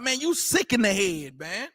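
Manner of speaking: lightly voiced street-style put-down; casual street talk. Preacher quoting how his own people would say it, casual street talk. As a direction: toss it off like talk on the block.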